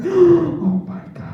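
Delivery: high, loud, drawn-out shock; hammed-up horrified exclamation; mock horror. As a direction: react like an appalled onlooker. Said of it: "just gasp in horror".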